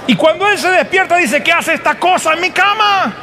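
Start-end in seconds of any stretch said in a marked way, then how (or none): 1.4-3.1 high, startled outburst; a horrified double take; shocked groom. Acted. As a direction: recoil at what he sees in the bed. Jacob wakes beside wrong bride.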